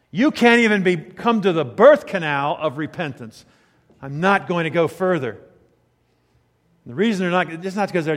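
loud, emphatic voicing of God's refusal; forceful preaching. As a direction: declare it as a final verdict from the pulpit. Preaches God's words at full force.

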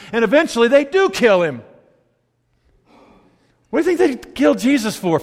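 high, driving sermon delivery; impassioned preaching. Real, not acted. Pastor arguing why they killed Jesus, impassioned. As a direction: hammer the argument home to the congregation.

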